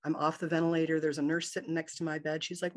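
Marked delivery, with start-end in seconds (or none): none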